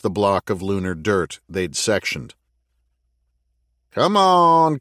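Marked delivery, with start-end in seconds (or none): 3.9-4.8 teasing urge. Acted. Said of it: "hear the smile on the edge".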